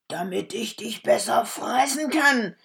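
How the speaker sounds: spring the trap with a shout; wolf roaring. A narrator's reading. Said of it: "rief der Wolf".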